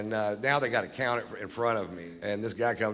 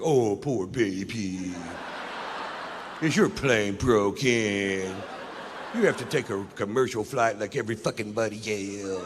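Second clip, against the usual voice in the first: mock sympathy. Friends mocking his broken plane, sarcastic pity. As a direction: imitate taunting pity, stretching the words.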